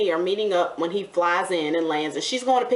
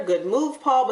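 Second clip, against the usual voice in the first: normal talking voice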